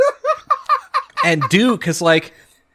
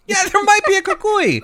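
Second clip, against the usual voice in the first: higher, laughing put-on voice; laughing mock echo. Joking echo of friend's warning.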